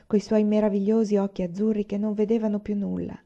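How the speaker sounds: usual speaking voice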